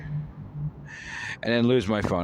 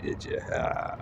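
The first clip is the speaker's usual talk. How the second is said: ordinary delivery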